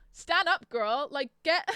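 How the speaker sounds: loud, high-pitched telling-off; punchy exclamations, pitched well up; exasperated scolding. Real, not acted. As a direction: raise the voice at a hopeless heroine. Scolding the fictional heroine.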